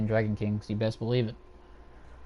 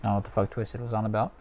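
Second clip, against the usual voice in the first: usual speaking voice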